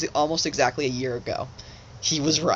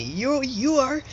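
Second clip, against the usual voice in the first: high, manic imitation of a wild prophet; mock-fanatical proclaiming, pitched way up; imitating a crazed philosopher insisting; crazy Nietzsche way; 10 semitones higher than usual. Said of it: "'cause he was saying it in his, like, crazy Nietzsche way".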